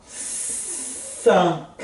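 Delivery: cinq whispered with a wide open nasal an. Shown as a demonstration. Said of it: "Bem abertão".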